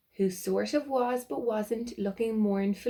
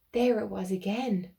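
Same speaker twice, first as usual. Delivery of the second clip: plain reading voice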